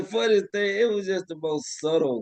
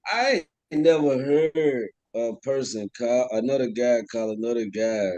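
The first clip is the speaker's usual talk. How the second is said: ordinary delivery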